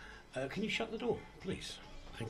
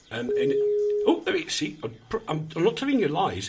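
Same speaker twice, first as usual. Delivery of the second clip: ordinary delivery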